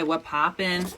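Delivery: ordinary talk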